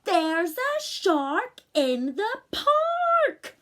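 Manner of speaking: very high, loud, slow shout; child shouting the refrain. Acted. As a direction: bellow the warning. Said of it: "he's about to shout".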